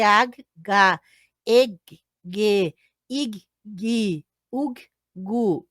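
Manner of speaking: ag, ga, eg, ge, ig, gi, ug, gu spelled out one by one; teacher sounding out the G syllables; syllables sounded out. Shown as a demonstration. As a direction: model each G syllable separately. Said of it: "o G vai ser".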